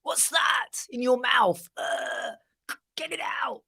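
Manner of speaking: high, slow, disgusted shriek of revulsion; mock disgust. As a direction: recoil in hammed-up disgust. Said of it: "I'm not gonna go".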